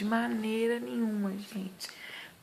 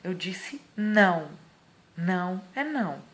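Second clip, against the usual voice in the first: louder, stern warning with raised eyebrow; firm command to come back now; 6 dB louder than usual. Shown as a demonstration. Mother scolding her toddler, stern and loud. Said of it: "levanto a sobrancelha e falo".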